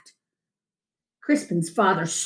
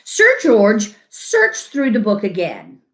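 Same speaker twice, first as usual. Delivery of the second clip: ordinary delivery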